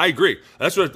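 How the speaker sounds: emphatic agreement. Real, not acted. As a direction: insist on "I agree", punched loud. Repeats agreement louder.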